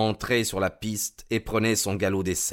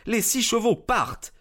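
excited call; 13 semitones higher than usual. A narrator's reading. Race start announced.

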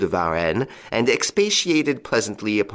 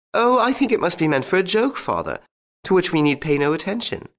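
lightly voiced young lady, much higher; young daughter's voice; 10 semitones higher than usual. A narrator's reading. Angelique's voice read in story.

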